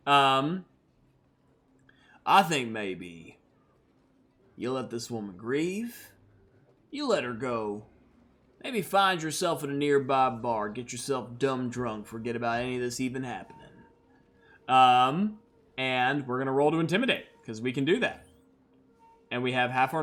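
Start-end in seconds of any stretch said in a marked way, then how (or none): 2.2-13.7 measured, unhurried cowboy menace; calm threat from a lone gunslinger; cool gunslinger. Acted. Red R warning the Targ guards off the grieving woman, calmly.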